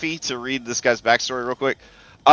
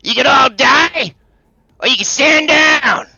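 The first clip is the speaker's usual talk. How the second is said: high, loud shout from a rooftop; 12 semitones higher, 7 dB louder than usual. Acted. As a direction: holler down to people below, stretching the words. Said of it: "shouts down at you guys".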